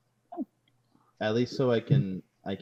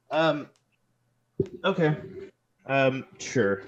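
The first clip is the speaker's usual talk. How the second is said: mildly nervous, higher and louder; a hesitant, uneasy yes in character; 5 semitones higher, 4 dB louder, slower than usual. Acted. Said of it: "but I say it very nervously".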